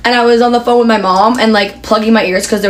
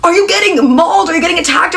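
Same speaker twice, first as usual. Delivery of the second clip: worried mom; 8 semitones higher than usual. Retold or imitated. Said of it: "My mom was like".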